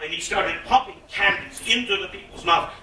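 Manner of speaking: ordinary delivery, nothing marked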